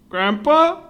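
high, loud questioning squeak; alarmed grandchild. Retold or imitated. Grandson at the table questioning Grandpa, high alarmed call. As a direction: call it up high in dawning suspicion. Acting dawning horror at the meal.

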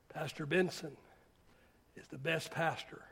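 very low, soft, spaced-out quote; hushed retold praise. Preacher quoting his former critic, hushed and low.

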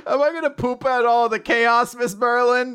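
high, loud, silly pupil voice; mock naive question. Arthur asks Miss Merlin about pooping chaos, mock naive. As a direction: pitch it way up, playfully dim.

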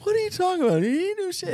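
very high-pitched, indignant mock denial; Jeremy. Buddy brushes off the lieutenant's beating, high-pitched imitation. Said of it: "like, higher pitch".

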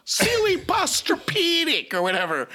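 high mocking excuse voice; mocking excuse-maker. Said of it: "That's what every fat person says".

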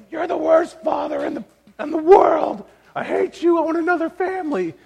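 put-on high, loud child screaming at dad; mock a kid yelling from the stairs; imitating child's tantrum. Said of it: "kicking and screaming and punching and yelling, be like".

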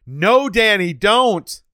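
high, loud mock pleading shout; hammed-up desperate cry to stop him; mock plea. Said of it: "a single person watching this movie going".

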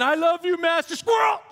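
loud, high, eager cartoon dog; talking dog. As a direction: shout it like the dog in Up. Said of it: "he's saying".

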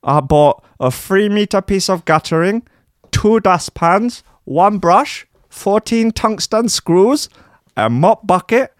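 high squeaky whiny Dutch DJ. Acted. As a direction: play a pompous squeaky superstar. [name] voice continues.

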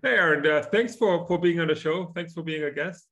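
bright, high, eager welcome; excited greeting. Real, not acted. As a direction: greet the guest with real enthusiasm. Host warmly greeting the guest.

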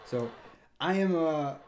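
ordinary talk

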